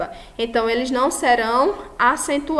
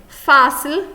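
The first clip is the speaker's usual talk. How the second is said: "fácil" with a loud stressed FÁ; first-syllable stress; 3 semitones higher, 6 dB louder than usual. Shown as a demonstration. Teacher giving an example of a grave, "fácil" with loud first syllable. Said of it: "a sílaba mais forte é quem".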